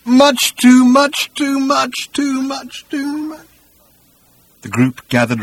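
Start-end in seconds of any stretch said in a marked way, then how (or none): 0.1-3.5 high wail fading to a quiet moan. A narrator's reading. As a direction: repeat it as a dwindling, drawn-out moan. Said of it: "moaning quietly".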